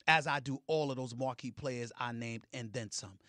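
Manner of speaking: ordinary talk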